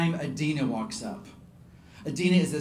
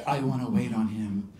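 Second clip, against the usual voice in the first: low-voiced Adina; 5 semitones lower than usual. Waitress claiming the star's table, low voice. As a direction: lower imitated Slavic voice. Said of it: "Adina spoke with a really low voice".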